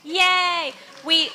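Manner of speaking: usual speaking voice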